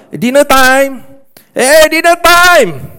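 high, loud call up the stairs. Retold or imitated. Pastor imitates a parent calling the family down, loud high holler. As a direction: holler dinner time across the house, slow and drawn out.

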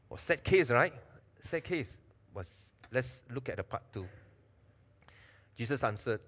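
normal talking voice

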